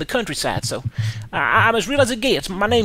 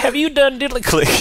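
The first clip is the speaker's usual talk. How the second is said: hillbilly voice; 4 semitones higher, 4 dB louder than usual. Acted. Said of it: "I'm from the countryside".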